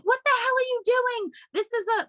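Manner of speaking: imitating a French woman, high and outraged; shrill-free mock French scolding, pitched well up; French accent. Angry French mother scolding a drunk couple, in French accent. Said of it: "with French accents".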